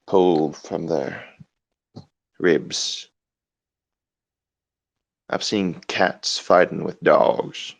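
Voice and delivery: haunted creepy narrator. Acted. Man telling staring animals of horrors, hushed and slow. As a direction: spin a creepy tale in a hush.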